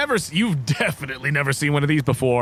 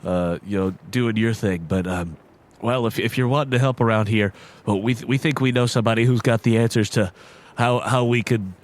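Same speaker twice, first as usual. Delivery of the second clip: hesitant, lower deer voice; lightly voiced shy deer; 4 semitones lower than usual. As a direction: explain nervously to the visitors. GM voices Randy the Sawsbuck.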